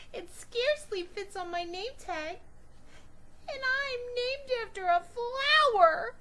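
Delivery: high, loud tearful wail; crying complaint. Acted. Girl crying to her parents about her name, high loud wail. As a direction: wail it through tears.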